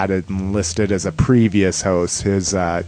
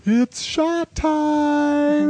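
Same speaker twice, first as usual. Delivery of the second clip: sing-song announcement; much slower than usual. Acted. Announcing shot time.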